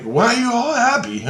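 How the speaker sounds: high-pitched re-enacted question; baffled protest. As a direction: challenge the celebrating crowd, pitch jumping up. Acts out baffled complaint to crowd.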